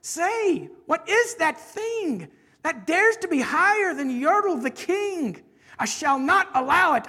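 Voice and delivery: indignant puffed-up monarch; affronted turtle king, defiant. Acted. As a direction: vow it grandly, building to a big command. Yertle rages at the moon.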